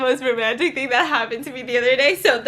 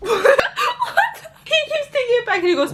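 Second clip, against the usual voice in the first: laughing; 11 semitones higher than usual. Real, not acted. Laughing while retelling.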